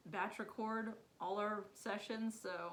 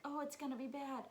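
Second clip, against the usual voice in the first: nervous worry; 6 semitones higher than usual. Retold or imitated.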